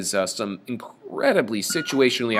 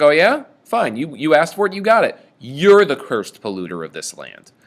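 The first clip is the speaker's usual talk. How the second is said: loud, higher angry retort; mad; 4 semitones higher, 7 dB louder than usual. Retold or imitated. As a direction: snap back, fed up. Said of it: "he gets mad himself".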